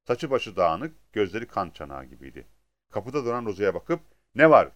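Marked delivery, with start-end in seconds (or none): 4.3-4.7 loud, abrupt annoyed demand; narrated grumble, punched out loud; gruff grumble. A narrator's reading. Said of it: "diye homurdandı". (tersçe, yüksek sesle homurdanma)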